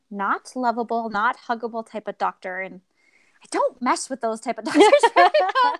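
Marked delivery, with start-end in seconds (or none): 3.4-5.8 higher, louder, breaking into laughter; amused, laughter bursting in mid-sentence; laughing. Real, not acted. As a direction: laugh through the admission. Laughs at her own remark.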